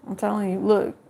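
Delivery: normal talking voice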